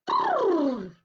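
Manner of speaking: high, loud lip-trill snort; horse snort. Acted. Horse snorting at the crying rabbit. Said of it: "snorted the horse".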